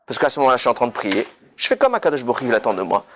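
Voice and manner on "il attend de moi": ordinary talk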